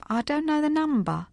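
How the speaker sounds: dubiously. A narrator's reading. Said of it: "said Dot dubiously".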